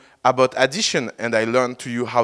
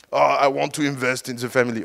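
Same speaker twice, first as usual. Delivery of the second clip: put-on Danish accent; faster than usual. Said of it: "I don't do the Danish accent well".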